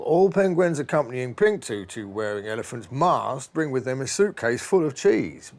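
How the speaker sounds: usual speaking voice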